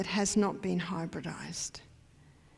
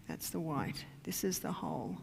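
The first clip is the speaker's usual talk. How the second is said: hushed aside; 4 semitones lower, 8 dB quieter than usual. Acted. Showing the whole wheat.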